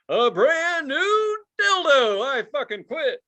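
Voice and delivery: game show host. Retold or imitated. Imitating a TV host quitting.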